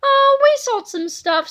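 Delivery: put-on high, loud boyish voice; Harold's voice. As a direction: chirp it out like a kid. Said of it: "said Harold".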